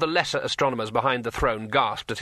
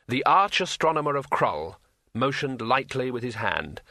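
usual speaking voice

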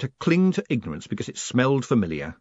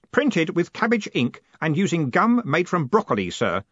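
eager, higher-voiced pride; full of pride; 7 semitones higher, 3 dB louder than usual. A narrator's reading. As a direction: proudly present your own handiwork. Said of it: "full of pride".

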